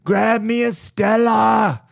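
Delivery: high, slow British lad shouting; mock British accent. London trash demanding a beer, shouted mock British accent. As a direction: bellow it like a hammed-up British yob. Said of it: "you get around the trash".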